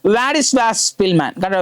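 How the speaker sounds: ordinary delivery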